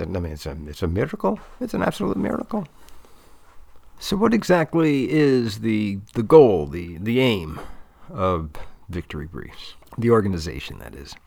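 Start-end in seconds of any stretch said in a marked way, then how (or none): 4.0-11.1 lightly voiced interviewer, slow and deliberate; interviewer role. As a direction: put the question slowly, like a measured interviewer. Poses interview question in slower voice.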